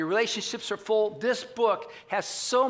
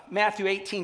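ordinary talk, nothing marked

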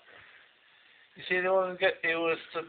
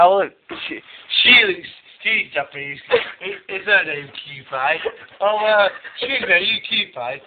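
loud, higher excited banter; 5 semitones higher, 9 dB louder than usual. Real, not acted. Joking to his mate about a girl's name, loud and excited. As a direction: play it up loudly for the camera.

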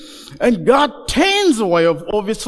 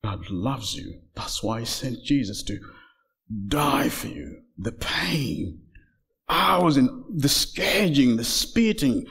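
very soft, low closing appeal; hushed solemn preaching; 11 semitones lower, 8 dB quieter than usual. Real, not acted. Preacher tells listeners God loves them as he finishes, hushed. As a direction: bring it down low and quiet, with weighty pauses.

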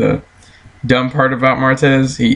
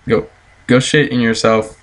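ordinary delivery

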